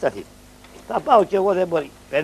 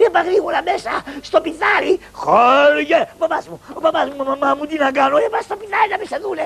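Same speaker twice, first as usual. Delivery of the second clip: panicked; 10 semitones higher than usual. Acted. Panicked hiding order.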